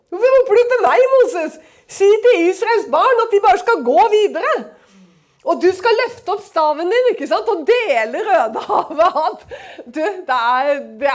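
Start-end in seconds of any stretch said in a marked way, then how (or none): 0.1-1.1 much higher, louder voice of God calling out; God rebuking Moses, voice rising sharply; booming divine repeat, pitched up; God's voice, escalating. Acted. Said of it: "Så kommer det fra Gud". 1.2-9.5 higher, louder commanding God; God instructs Moses, lifted commanding voice; God's voice. Acted. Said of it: "Så kommer det fra Gud".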